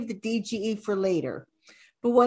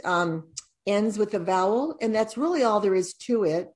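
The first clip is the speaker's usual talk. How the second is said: ordinary delivery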